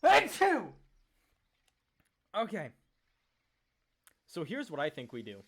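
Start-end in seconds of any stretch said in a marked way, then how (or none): 0.0-0.6 loud sudden sneeze; a real, explosive achoo; sneezing. Real, not acted. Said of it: "Pardon me, I have to sneeze".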